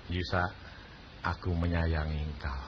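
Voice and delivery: low, soft loving words; hushed, deep divine tenderness; tender voice of God. Retold or imitated. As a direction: reassure him like a loving father. God speaks love to him.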